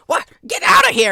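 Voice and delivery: high, loud furious yell; angry shout. Acted. Fisherman yelling at the player sinking his boat, furious shout. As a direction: scream at the intruder to get lost. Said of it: "And he's like".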